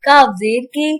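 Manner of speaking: measured sing-song spelling, long held final syllables; Qaida spelling drill. Shown as a demonstration. Teacher spelling tanween words aloud for children, sing-song. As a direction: chant each letter and sound like a reading drill.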